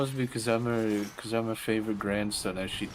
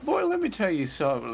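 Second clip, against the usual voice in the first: grandma voice; 11 semitones higher, 3 dB louder than usual. Retold or imitated. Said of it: "And like".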